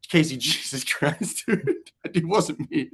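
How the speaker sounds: slow, laughing exasperated denial. Retold or imitated. Johnny laughing off Casey's blame, slowly. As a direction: protest while cracking up.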